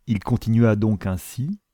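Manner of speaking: plain reading voice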